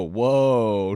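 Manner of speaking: ordinary talk, nothing marked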